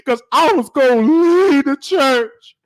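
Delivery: high, hammed-up tearful whine; mock crying. Bishop mimics the crying sister, high mock sob. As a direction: parody her blubbering a little. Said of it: "don't cry like".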